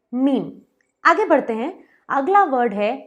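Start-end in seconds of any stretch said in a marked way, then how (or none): 0.1-0.5 मीम rhyming with "team"; one long-ee syllable in मीम; correct pronunciation. Shown as a demonstration. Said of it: "इसे पढ़ें". (मीम में लंबी "ई" बोलें)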